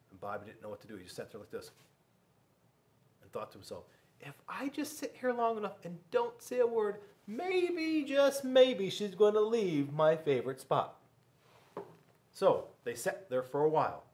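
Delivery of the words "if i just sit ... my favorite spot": put-on hopeful boy. Boy's hopeful inner thought.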